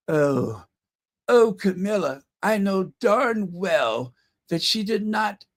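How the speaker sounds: lightly voiced fretting King Charles, pitch creeping up; a hint of an anxious old king; mock-worried royal muttering to his wife; bad King Charles impersonation. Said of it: "That is my worst King Charles impersonation ever".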